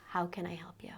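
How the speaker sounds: put-on soft, whispered, kneeling to a child's level; calm whisper. Shown as a demonstration. Parent calmly whispering to a screaming toddler. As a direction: whisper it to settle a crying child. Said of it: "calmly say".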